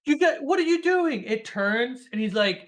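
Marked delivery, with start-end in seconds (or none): 0.1-1.2 high, loud alarmed question; panicked movie sister. Retold or imitated. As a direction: act out the sister, pitched way up. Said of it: "the sister's like".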